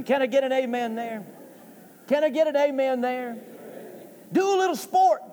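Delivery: high-pitched preacher's call to the congregation; rallying the crowd from the pulpit; calling for amens. Real, not acted.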